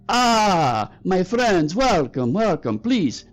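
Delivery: high, effusive welcome in a fake Korat accent. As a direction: greet customers grandly in a terrible put-on Korat accent. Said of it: "fake Korat accent you've ever heard".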